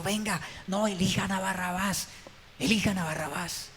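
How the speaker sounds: preacher voicing a false leader luring believers; seductive false leader. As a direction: lure the crowd sweetly toward the wrong choice. False leaders seducing toward Barabbas.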